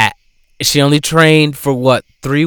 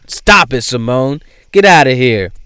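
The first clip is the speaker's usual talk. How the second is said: ordinary talk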